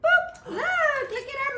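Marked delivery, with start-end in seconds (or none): none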